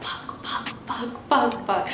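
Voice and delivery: imitated under-the-breath cursing; hushed, whispered fretting; panicked whisper. Scared friend cursing beside her in the back seat, whispered.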